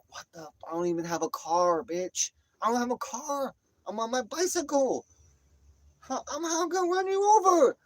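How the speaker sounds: climbing into very high incredulous squawk; exasperated protest. Retold or imitated. Man on bicycle answering her accusation, exasperated mock protest.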